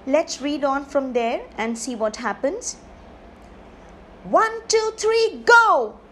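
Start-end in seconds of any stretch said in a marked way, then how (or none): 4.2-6.0 high, loud countdown into GO; race-start shout. Acted. Starting the pony race at Strawberry Hills, excited shout. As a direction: call the riders off with a big shout.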